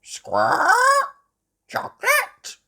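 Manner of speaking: very high, loud squawking parrot. A narrator's reading. Polly copying Granny, high squawking parrot. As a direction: screech it like a parrot mimicking. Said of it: "Polly Parrot copied".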